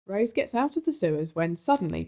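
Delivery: plain reading voice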